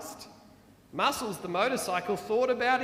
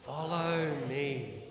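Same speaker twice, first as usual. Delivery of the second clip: slow calm call; 7 semitones lower, slower than usual. Acted. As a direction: lead gently up the steep hill. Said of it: "drove on slowly and said".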